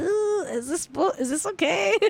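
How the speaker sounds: nervous little worried voice; unsure Adele. Retold or imitated. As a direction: ask it like you fear you messed up. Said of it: "She looks kind of like".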